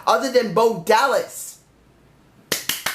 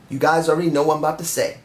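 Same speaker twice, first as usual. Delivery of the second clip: normal talking voice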